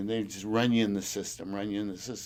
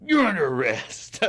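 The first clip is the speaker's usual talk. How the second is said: higher, louder cop arrest line; loud cop announcing an arrest; mock arrest; 7 semitones higher, 6 dB louder than usual. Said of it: "He goes".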